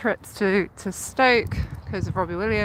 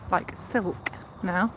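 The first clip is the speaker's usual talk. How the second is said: usual speaking voice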